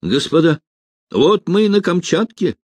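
put-on high, eager young cadet; fervent, high-pitched excitement; ardent. A narrator's reading. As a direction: announce it with keen enthusiasm. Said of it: "горячо говорил юнкер Ухтомский".

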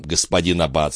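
ordinary delivery

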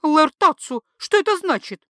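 wife demanding an answer. A narrator's reading. (требовательная жена)

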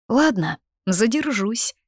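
light, high-pitched giving in; lightly amused, lifted voice; amused concession. A narrator's reading. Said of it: "усмехнулась Лита".

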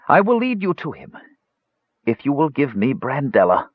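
high-pitched, intent wizard bargaining; ailing old mage. Acted. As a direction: strike the deal as a failing mage.

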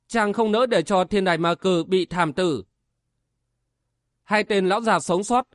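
ordinary delivery, nothing marked

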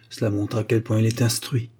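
ordinary delivery

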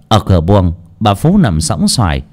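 ordinary delivery, nothing marked

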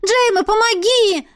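high pleading call; desperate cry. A narrator's reading. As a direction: cry out for help in despair. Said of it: "простонала я". (высокий отчаянный зов)

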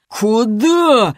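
high, drawn-out bellow; drunken roar. A narrator's reading. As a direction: roar it after fleeing girls. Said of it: "взревел им вслед". (пьяный рёв вслед)

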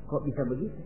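low, very soft query; puzzled question. Acted. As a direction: ask it quietly, bewildered. Hajar questions the devil. (bertanya lirih, heran)

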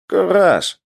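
high, loud call to the commander. A narrator's reading. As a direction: call sharply to get his attention. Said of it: "окликнул командира ещё один боец". (громкий оклик)

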